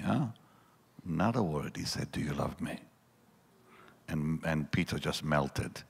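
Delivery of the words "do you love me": soft, hushed and tender; gentle question. Retold or imitated. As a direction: ask it gently, no rebuke. Jesus gently asks Peter.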